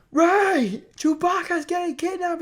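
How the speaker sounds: very high mock-frantic shout; imitated panicked yelling. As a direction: yell the alarm like a panicking movie hero. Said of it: "Finn is always yelling".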